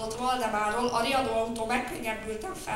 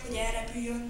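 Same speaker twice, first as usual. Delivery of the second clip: plain reading voice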